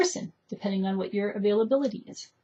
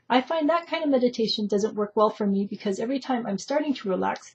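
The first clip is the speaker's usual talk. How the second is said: normal talking voice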